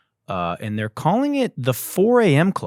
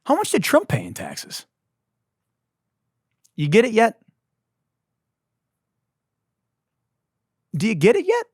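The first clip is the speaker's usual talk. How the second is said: taunting; 9 semitones higher than usual. Acted. High taunting voice.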